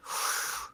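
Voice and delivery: long breathy sigh. Real, not acted. Regretting a great fight card, a long sigh. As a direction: let out a long, voiceless sigh.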